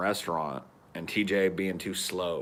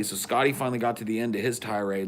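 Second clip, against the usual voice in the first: usual speaking voice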